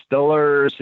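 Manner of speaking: "Stillers" said with an i sound, Pittsburgh style; Pittsburgh "Stillers" for Steelers; Pittsburgh pronunciation. Retold or imitated. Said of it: "they say".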